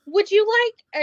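salesperson sweetness. Retold or imitated. Dillard's clerk offering makeup, high and sugary. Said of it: "and they'll be like".

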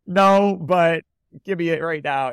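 high, loud mock-desperate plea; eager yes. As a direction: jump at the offer, hammed up. Eager reply to Ativan offer.

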